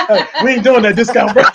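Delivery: laughing while talking; chuckling through the words. Real, not acted. Laughs off slipped discount mention.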